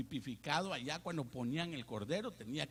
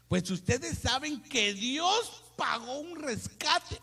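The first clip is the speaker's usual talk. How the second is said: slow, higher, solemn reading; scripture reading; 9 semitones higher, 5 dB louder, much slower than usual. Acted. Preacher reading First Peter aloud, slow solemn reading. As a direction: read each word slowly to the church.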